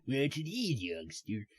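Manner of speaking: soft, mimicked old-timer drawl; Harold impression. Player fondly mimicking the game character, soft put-on voice. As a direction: quietly mimic a quirky character's catchphrase. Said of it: "the way he says that".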